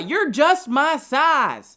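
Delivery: a heckler's slow southern drawl; southern accent. Retold or imitated. Heckler yelling at a short comic, slow southern accent. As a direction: drag it out in a put-on southern accent. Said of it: "the weirdly southern accent".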